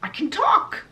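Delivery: put-on high, bright boyish wonder rising at the end; amazed puppet boy. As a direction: squeak it out like a puppet hearing his own voice. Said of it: "And he's like".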